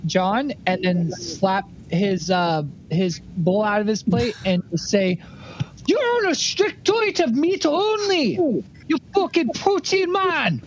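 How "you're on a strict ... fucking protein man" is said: high, slow, drawn-out character voice; blustering Dago character. Acted. Scolding John over his diet, high blustering character voice. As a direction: stretch each word in a high-pitched rant.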